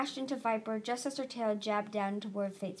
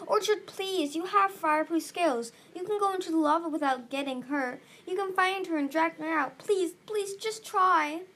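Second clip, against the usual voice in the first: high, urgent begging; desperate pleading; 6 semitones higher, 4 dB louder than usual. A narrator's reading. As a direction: beg them frantically to act. Desperate pleading to save Viper.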